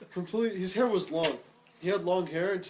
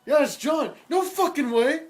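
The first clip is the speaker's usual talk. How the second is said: higher, louder surprised quote; disbelief; 6 semitones higher, 5 dB louder, slower than usual. Retold or imitated. Retelling his shock at seeing John, higher pitched. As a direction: act stunned at the news. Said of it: "I'm like".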